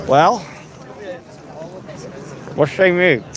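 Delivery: usual speaking voice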